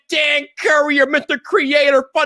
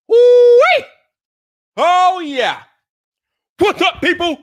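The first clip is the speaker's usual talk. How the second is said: shouted, high hype-man opener, drawn out and roaring; hype show intro; 6 semitones higher, 4 dB louder, slower than usual. Acted. Host opening his Friday night live stream, shouting hype-man style. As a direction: pump up the crowd like an arena announcer.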